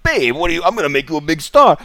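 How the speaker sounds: hammed-up schmoozing mogul; a sleazy Hollywood boss pitching loudly; sleazy producer. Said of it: "He'll be like".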